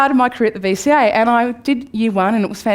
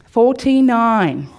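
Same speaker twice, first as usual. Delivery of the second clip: slow reveal; 3 dB louder, much slower than usual. Real, not acted. Dramatic reveal of the mark.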